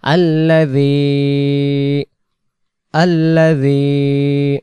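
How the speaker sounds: আল্লাযী with the ذ from the tip of the tongue. Shown as a demonstration. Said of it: "জিব্বার আগা থেকে". (জিভের আগা দিয়ে আল্লাযী)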